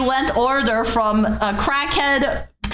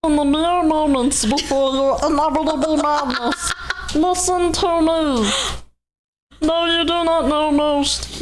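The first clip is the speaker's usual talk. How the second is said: Cthulhu voice. Acted. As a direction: intone it like an ancient deity. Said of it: "The Cthulhu voice".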